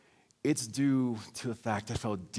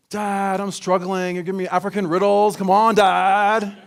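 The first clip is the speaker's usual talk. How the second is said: put-on exasperated teen; 8 semitones higher, 11 dB louder than usual. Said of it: "So I'm sitting here like".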